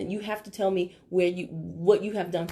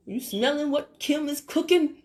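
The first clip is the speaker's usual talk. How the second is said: Rock catchphrase; 5 semitones higher, 4 dB louder than usual. Retold or imitated. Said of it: "like The Rock".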